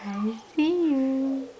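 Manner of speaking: stretched slow I see you; playful slow peekaboo. Acted. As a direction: draw out the peekaboo teasingly. Peekaboo with baby.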